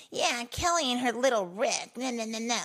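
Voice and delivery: mock her like a catty rival; imitating a rival's teasing about Rick. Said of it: "she's like".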